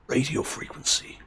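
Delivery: whispered tough soldier voice; hushed gruff codec whisper; Snake voice. Shown as a demonstration. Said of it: "Can I do the snake voice?".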